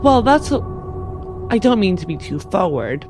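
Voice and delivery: ordinary talk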